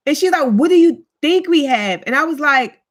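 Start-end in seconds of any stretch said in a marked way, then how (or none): 0.5-2.0 higher-pitched, indignant retort; mock indignation. Offended friend answers ignorant question, imitated indignation. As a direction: throw the question back, affronted.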